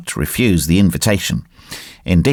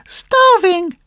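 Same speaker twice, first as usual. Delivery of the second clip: echoing cat. Acted. Cat repeats the fox's word, high echoing voice. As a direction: pipe up with a high-pitched echo. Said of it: "repeated the cat".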